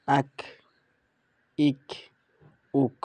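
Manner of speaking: আক ইক উক, প্রতিটি আলাদা করে; reading drill. Shown as a demonstration. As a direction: model each syllable. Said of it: "আমি কিছু কিছু উচ্চারণ করে দিচ্ছি". (ধীরে স্পষ্টভাবে উচ্চারণ)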